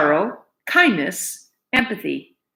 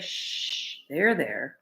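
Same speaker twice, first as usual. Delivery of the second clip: soft, lowered shushing; soothing hush; 5 semitones lower, 10 dB quieter, much slower than usual. Acted. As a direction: soothe a weeping friend. Louise soothing Cook.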